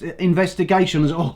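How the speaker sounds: ordinary delivery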